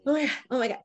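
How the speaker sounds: mock racing heart, nervous; a touch of nervous panic; retold panic. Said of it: "heart is thumping".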